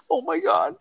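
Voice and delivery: very high, squeaky fake terror; hammed-up frightened kid about to hang up; pretend tearful panic. Prank caller ending the helpline call, mock panic. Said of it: "pretending to cry".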